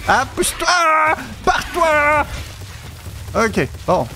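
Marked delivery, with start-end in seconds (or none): 0.1-2.2 shouting. Real, not acted. Shooing a dinosaur away.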